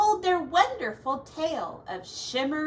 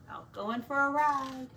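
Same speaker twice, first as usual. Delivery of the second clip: plain reading voice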